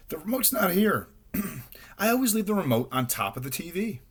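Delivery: high, worried rabbit; panicky. A narrator's reading. Rabbit panics over missing remote.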